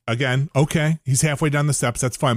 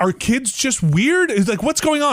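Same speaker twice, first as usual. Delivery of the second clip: mock bewildered; 9 semitones higher than usual. Husband baffled asking his wife, higher incredulous voice. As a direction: ask it pitched up in disbelief.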